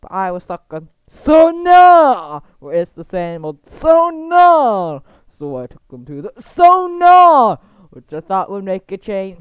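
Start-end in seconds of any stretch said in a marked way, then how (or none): 1.1-2.4 loud, drawn-out high yell; screamed place name. Pretend. 3.7-5.0 loud, drawn-out high yell; screamed place name. Pretend. 6.4-7.6 loud, drawn-out high yell; screamed place name. Pretend.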